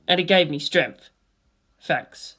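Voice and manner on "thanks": soft, lower, thinking it to himself; barely voiced thanks in his head; quiet inner voice; inner thought. A narrator's reading. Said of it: "I thought".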